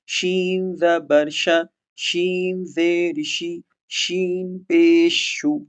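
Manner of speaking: slow syllable drill; slow spelling drill. Shown as a demonstration. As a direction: spell شین زبر ش with the vowel drawn out. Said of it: "مثال سے آپ سمجھیے".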